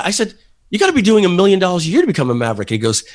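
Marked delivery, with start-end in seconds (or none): 0.7-2.7 fast, higher incredulous protest; astonished objection, rattled off quickly; retold incredulity. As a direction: blurt it out in disbelief, higher. Retells his incredulous objection.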